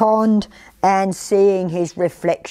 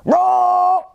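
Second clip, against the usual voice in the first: high, drawn-out play roar; little tiger roar; 11 semitones higher than usual. A narrator's reading. Little tiger trying to frighten the forest, high roar. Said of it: "No one jumped when he roared".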